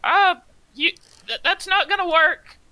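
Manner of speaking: high, loud, alarmed gnome voice; Ula's voice. Acted. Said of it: "Ula, who's still up on the ledge, is gonna say".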